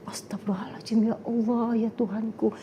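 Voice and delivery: hushed, breathy shocked whisper; whispered prayer. Retold or imitated. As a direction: whisper it in disbelief. (bisikan kaget sambil beristigfar)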